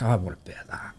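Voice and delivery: hushed, secretive whisper; soft conspiratorial whisper of desire; whispered longing. Retold or imitated. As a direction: whisper it like a private craving. Wanting the lead work, secretly.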